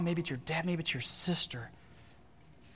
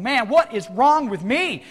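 exasperated self-questioning; 7 semitones higher, 10 dB louder, slower than usual. Acted. Voicing a frustrated believer's self-questioning.

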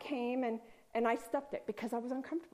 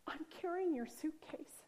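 higher, softer unvoiced whisper; awed whisper; 4 semitones higher, 6 dB quieter than usual. Woman hugging Karen, telling her in an awed whisper. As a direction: hush it like a secret wonder.

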